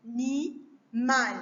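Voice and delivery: "Animal" slowly repeated as A... ni... mal; three spaced beats in "Animal"; split into syllables. Shown as a demonstration. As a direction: dictate "Animal" one syllable at a time. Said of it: "couper les mots en syllabes".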